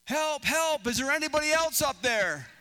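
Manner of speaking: high, loud cry for help; hammed-up panicked shout for rescue; Jack. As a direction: yell up the cliff in desperation. Jack yells up for other help.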